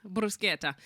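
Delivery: bruschetta with a hard k, Italian style; Italian pronunciation. Shown as a demonstration. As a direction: say bruschetta as brus-KET-ta. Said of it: "that's how you have to say it".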